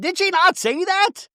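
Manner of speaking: very high, slow imitated yell; mock yell. Heather yelling at Lisa, very high mock yell. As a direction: yell it up high, hitting not. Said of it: "Heather's yelling".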